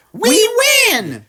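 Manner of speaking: very high loud triumphant scream; screamed we win. Acted. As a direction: crow it out at the top of your voice. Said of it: "screamed the guests".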